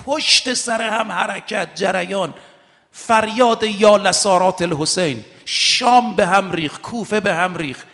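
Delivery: fervent pulpit oratory; rising sermon fervour. Acted. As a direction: build the list with mounting passion. Listing revolts with rising fervour.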